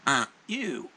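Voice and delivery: loud parrot squawk. Acted. Parrot to the guy who looks over, loud squawky parrot voice. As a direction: call out loudly as a taunting parrot. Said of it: "the parrot says".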